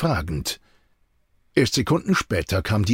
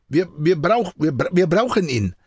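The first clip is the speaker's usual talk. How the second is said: raised pitch, broken restarts; stammering; 5 semitones higher than usual. A narrator's reading. Nervous assistant explains himself to Jean, stammering. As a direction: trip over the words and start over. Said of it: "stammelte er".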